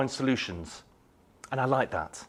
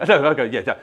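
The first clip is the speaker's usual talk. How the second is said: ordinary talk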